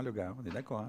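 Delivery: ordinary talk, nothing marked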